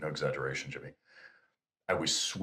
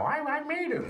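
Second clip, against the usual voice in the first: very high, put-on voice of a proud applicant; mock boaster; 5 dB louder than usual. SEAL veteran imitating applicants who barely qualified, high mocking brag. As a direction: parody their bragging in a squeaky-high voice.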